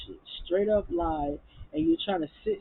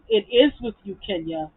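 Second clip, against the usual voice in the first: loud retort; 3 semitones higher, 8 dB louder than usual. Retold or imitated. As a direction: imitate her getting loud, hit "is" hard. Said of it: "Toya gets loud and says".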